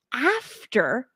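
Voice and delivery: after drawn out with a rising tune; stress on after. Shown as a demonstration. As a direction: lean on after, let it rise and hang. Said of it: "I'm emphasizing the word after".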